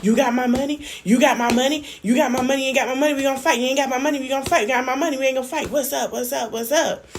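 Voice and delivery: mock him, pushy and taunting; imitating Chef D. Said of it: "I was like".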